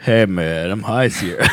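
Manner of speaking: a hint of a laid-back stoner. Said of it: "Great stoner impression".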